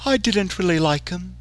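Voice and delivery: put-on high, soft, gleeful menace from the clown girl; sadistic clown smile. A narrator's reading. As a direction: smile as you say it, creepy and light. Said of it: "with a sadistic smile".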